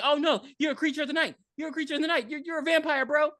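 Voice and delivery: high, panicky accusation; hammed-up horrified realization; mock alarm. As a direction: imitate a freaked-out kid brother. Acts brother accusing brother of vampirism.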